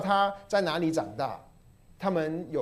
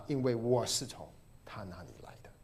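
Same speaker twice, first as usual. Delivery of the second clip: soft whispered scripture line; hushed whisper; 6 semitones lower, 9 dB quieter than usual. Acted. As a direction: breathe it out almost unvoiced, softer. Rereads same quote softly.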